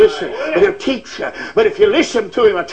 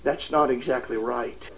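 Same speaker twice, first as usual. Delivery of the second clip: much lower and softer, a gentle inner voice; still small voice; 10 semitones lower, 10 dB quieter than usual. Acted. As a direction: hush it like God speaking within. Quoting God's still small voice.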